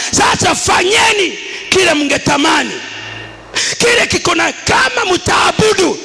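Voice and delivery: high, shouted challenge, stretched at the end; full-force preaching. Acted. Joshua challenging his people on whom to worship, full-force preaching. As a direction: cry it out like a leader challenging the people.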